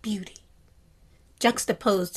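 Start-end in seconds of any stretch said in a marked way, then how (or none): 0.0-0.4 hushed awe. Retold or imitated. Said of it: "And I'm just like".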